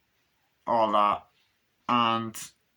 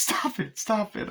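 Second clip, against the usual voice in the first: acting scared; 10 semitones higher than usual. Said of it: "she's so good at acting scared".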